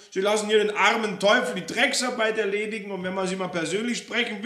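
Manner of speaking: high, loud, rushing tirade; outraged scolding without letup; Frau Roller furious. Acted. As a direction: let rip at the landlord, building to insult.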